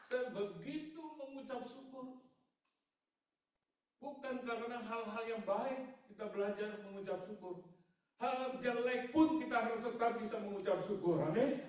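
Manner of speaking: high, loud pulpit exhortation; full-force preaching. Real, not acted. Preacher urging the congregation to give thanks, full-force preaching.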